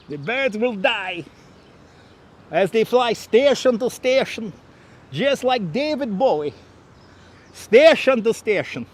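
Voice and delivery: put-on comic Russian accent, swooping upward; mock-dramatic Russian accent. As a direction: put on a melodramatic Russian bird feeder's accent. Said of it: "workout doing this damn accent".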